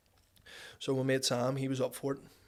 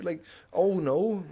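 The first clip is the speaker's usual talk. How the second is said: mock dismay; 4 semitones higher, 7 dB louder, much slower than usual. Mocking having to stop.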